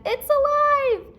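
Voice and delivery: quoting Annie. Retold or imitated. Teacher voicing scared Annie. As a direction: squeal it like a scared girl. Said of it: "where she said".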